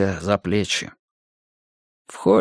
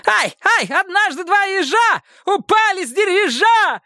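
high manic shriek; shrill shrieking. Acted. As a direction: shriek nonsense like a madman. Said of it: "истошно верещать".

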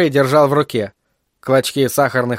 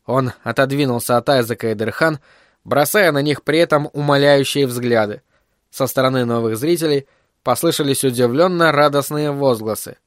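usual speaking voice

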